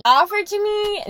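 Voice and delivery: "Offered to me" pushed high and loud; emphatic repeat. Real, not acted. Emphatic repetition.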